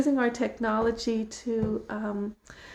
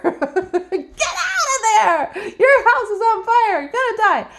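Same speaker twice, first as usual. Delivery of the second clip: loud, high alarm through laughter; urgent yell; 9 semitones higher, 7 dB louder than usual. Retold or imitated. Yelling at a sleeping neighbour, urgent shout. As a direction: drag them out with your voice. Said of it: "true kindness".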